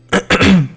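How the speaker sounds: loud throat clear. Real, not acted. Loud throat clear before line.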